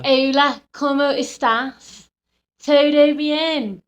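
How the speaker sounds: Spanish in a broad Scouse lilt; Scouse accent. Shown as a demonstration. Showing co-host British-accented Spanish, loud Scouse. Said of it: "I think Scouse goes quite well".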